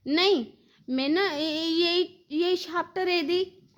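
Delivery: high, lisping toddler imitation; little child. Little Madhav insists caretaker clean him, lisping child voice. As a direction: act a small child stumbling over the words, high and babyish. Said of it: "ऐसे बोलता है".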